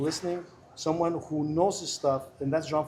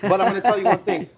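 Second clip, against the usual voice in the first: teasing, with a laugh, noticeably louder; 3 semitones higher, 7 dB louder than usual. Real, not acted. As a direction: laugh through the line, playful. Jokes about hiding his age.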